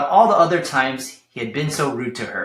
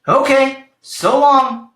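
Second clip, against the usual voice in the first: high, loud chirpy goodbye; sing-song a bright farewell; cheerful tumbleweed waving off a grump, high and loud; cheerfully; 9 semitones higher, 6 dB louder, slower than usual. Acted. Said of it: "said Rosie cheerfully".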